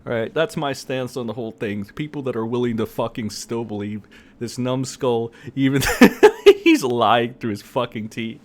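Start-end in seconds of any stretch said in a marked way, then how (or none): 5.9-6.6 sudden high, loud laugh. Real, not acted. Host mocking an obvious lie, laughing out loud. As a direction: burst out laughing.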